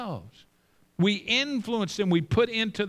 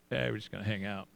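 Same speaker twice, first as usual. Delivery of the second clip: low, soft, noncommittal reply; offhand kid; 10 semitones lower, 9 dB quieter, much faster than usual. Retold or imitated. Kid brushing off dad's questions, low and offhand. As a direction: shrug it off quietly as the kid. Kid's reply to exasperated dad.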